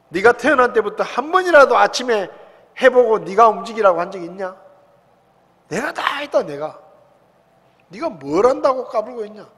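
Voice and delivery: loud, cornering questions from God; God hammering Job with questions; pressing rebuke. Retold or imitated. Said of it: "계속 몰아붙이십니다".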